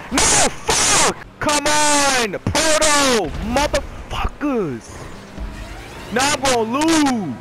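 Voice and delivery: high, exasperated shouting; frustrated yelling. Real, not acted. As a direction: howl in frustration at losing. Losing the race, swears loudly.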